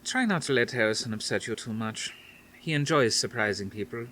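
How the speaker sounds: a hint of a Dutch grandmother; faint Dutch accent. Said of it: "her faint Dutch accent".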